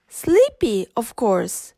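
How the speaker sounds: ordinary delivery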